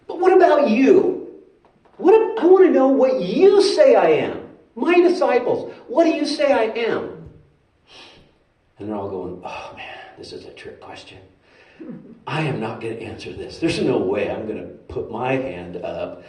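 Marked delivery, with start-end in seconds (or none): 0.1-7.3 high, emphatic challenge; urgent, high-pitched questioning; insistent Jesus. Acted. 9.4-16.2 low, hushed aside; quiet, dodging under-the-breath grumble; muttered reluctance. Acted. Disciples mutter, avoiding the question.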